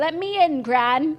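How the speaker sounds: bad British accent. Begging gran to open up, hammed-up British accent. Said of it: "British accent".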